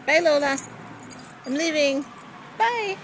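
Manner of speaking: calling goodbye. Real, not acted. Owner calling goodbye to the cat, loud. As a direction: call out goodbye as you leave.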